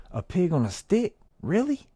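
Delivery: hammed-up disbelief at a pig on a stick; incredulous acting. Shown as a demonstration. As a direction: sell it like you can't believe it. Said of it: "Put some of that acting shit in there".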